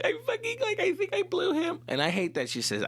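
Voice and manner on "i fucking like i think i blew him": very high, crying girlfriend imitation; mock tearful confession. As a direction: whimper the confession in a squeaky pitch. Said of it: "she's crying, she's feeling guilty".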